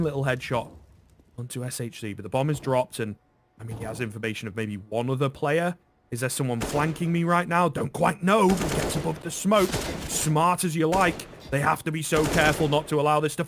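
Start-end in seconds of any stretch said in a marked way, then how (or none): none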